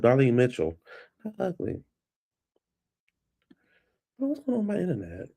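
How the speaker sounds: normal talking voice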